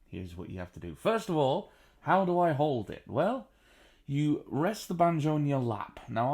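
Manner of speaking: ordinary talk, nothing marked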